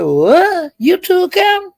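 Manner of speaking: high, loud disbelieving What?; retold surprise. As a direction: shriek it in shocked disbelief. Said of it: "I said".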